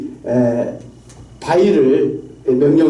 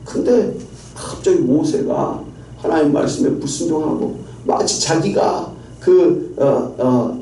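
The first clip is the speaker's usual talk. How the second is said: usual speaking voice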